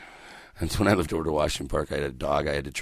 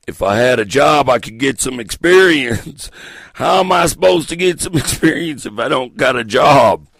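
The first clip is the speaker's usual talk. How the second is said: frustrated yelling; 9 semitones higher, 13 dB louder than usual. Acted. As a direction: yell it as an exasperated job seeker. Acting out frustrated job seeker.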